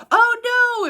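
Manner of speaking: high, loud mock panic; mock horror. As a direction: cry out like a fussy kid. Picky kids touching other foods.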